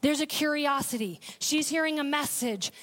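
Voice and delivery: normal talking voice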